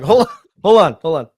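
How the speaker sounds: loud, high mock-urgent interruption; urgent stalling. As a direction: play someone stalling in an emergency. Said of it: "plane's going down and Jason's like".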